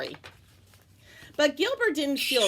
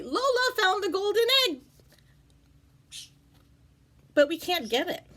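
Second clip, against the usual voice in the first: higher, calling voice; lightly voiced Gilbert; 10 semitones higher than usual. Gilbert calling to Wally, higher voice. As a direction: call over to Wally for help.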